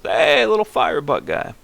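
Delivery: high, playful character voice; cheery high-pitched hail; high character greeting. Acted. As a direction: pipe up to a little creature. Calls out as game character.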